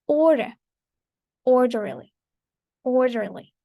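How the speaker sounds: "Order" and "orderly" punched on OR; first-syllable stress. Shown as a demonstration. Teacher modelling words for repetition, "Order, orderly" stressed on the first syllable. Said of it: "stress is placed on the first syllable".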